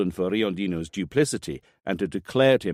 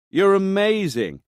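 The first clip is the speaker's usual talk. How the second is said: high, slow, gushing praise; delighted praise; 12 semitones higher, 5 dB louder, slower than usual. Acted. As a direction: gush with delight. Montanari praises Greta on phone.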